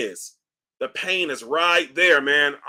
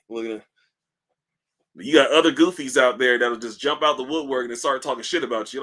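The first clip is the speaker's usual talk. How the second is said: normal talking voice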